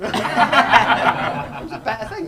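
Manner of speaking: very slow, laughing theatrical line; drawn-out, laughing grand reading; mock dramatic recital. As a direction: ham it up through a laugh. Mock recital of famous poems.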